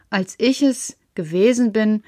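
ordinary delivery, nothing marked

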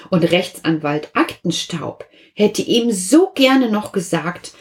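usual speaking voice

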